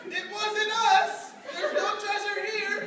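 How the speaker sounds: high, loud, slow denial; high kid voice. Acted. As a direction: squeak it out like a guilty kid. Kid denying to leprechaun.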